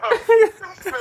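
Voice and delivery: high, loud laughing protest; loud outburst. Real, not acted. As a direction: shout it out in disbelief.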